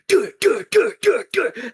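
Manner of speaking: high, loud repeated thuds; rapid-fire vocal knocking sound; imitated impacts. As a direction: mimic a head bonking on trees. Imitates head hitting trees.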